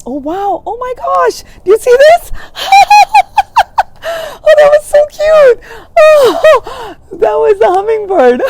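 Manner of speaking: high, loud squeals of delight with laughter. Real, not acted. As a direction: shriek with joy at a hummingbird. Sees a hummingbird, delighted.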